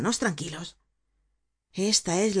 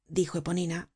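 ordinary delivery

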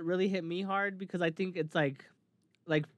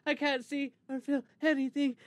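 imitating Max; 7 semitones higher than usual. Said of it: "she's like".

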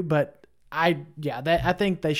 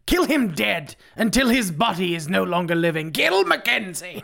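imitating Cesar Romero; 4 semitones higher than usual. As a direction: drag out each kill like a campy villain. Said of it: "the late, great Cesar Romero".

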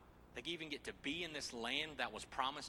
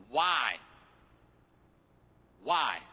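booming why; 10 dB louder than usual. Acted. Goliath's challenge read aloud.

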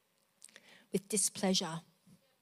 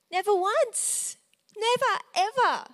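emphatic; 10 semitones higher, 6 dB louder than usual. Real, not acted. Preacher insisting Jesus never sinned, emphatic cry. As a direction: hammer each never home.

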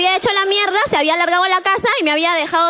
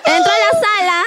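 grito con risa, más agudo; suéltalo a gritos riendo; gritando; 12 semitones higher, much slower than usual. Real, not acted. Clímax de la anécdota, grita. (gritando entre risas)

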